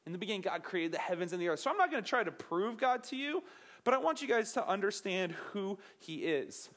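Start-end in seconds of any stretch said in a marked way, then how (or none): none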